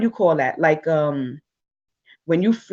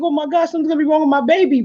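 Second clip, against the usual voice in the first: fast, higher panicked rush; hyper frenzy; 7 semitones higher, faster than usual. Retold or imitated. Mother retelling her panic about the baby, fast and high. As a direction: rattle it off in a mock panic. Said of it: "going into this hyper frenzy".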